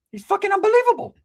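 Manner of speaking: mocking cousin's whine; pinched high-pitched mockery; high squeaky voice. Mocking Ricky's voice.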